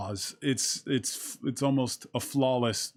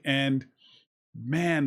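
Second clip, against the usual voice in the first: ordinary talk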